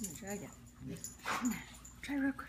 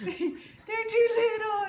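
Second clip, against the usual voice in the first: laughing; 12 semitones higher, 9 dB louder, much slower than usual. Real, not acted. Overalls won't fit dog.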